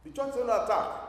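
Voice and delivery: urgent proclamation; emphatic alarm. Shown as a demonstration. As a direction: announce it like a warning. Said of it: "So if I said to you now".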